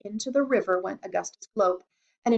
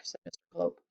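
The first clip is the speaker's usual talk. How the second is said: ordinary delivery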